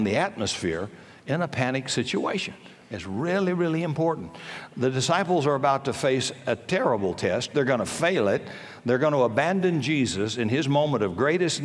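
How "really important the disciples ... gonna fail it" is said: normal talking voice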